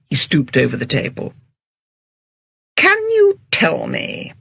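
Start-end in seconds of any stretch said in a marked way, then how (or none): 2.8-4.3 thin, high, slow and strained. Acted. Agatha asking the head waiter, thin voice. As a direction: pinch the voice up thin. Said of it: "said Agatha in a thin voice".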